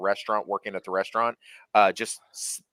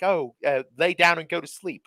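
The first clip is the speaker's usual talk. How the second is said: mock British coworker; 7 semitones higher than usual. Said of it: "And they're like".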